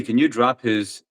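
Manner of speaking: ordinary talk, nothing marked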